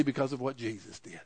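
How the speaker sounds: a soft, hushed reverent answer. Acted. Pastor giving the congregation the answer, softly hushed. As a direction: drop to a near hush on the answer.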